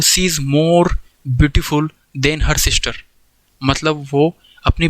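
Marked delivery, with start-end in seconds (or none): 0.0-2.9 1 slow dictation. Shown as a demonstration. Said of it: "अगर मैं बोलूँ".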